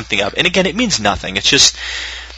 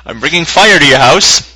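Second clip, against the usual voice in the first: slow, louder, higher mock menace; mock threat; 5 semitones higher, 6 dB louder than usual. Retelling an emailer's threat, hammed-up menace. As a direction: ham up the fire threat. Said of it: "He'll come with some fire".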